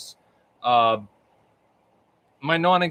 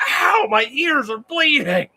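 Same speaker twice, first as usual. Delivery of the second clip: high, drawn-out wail of complaint; hammed-up howl of hurting ears; mock pained cry; 11 semitones higher, 3 dB louder, slower than usual. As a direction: play up the pain, loud and high. Acted reaction to being yelled at.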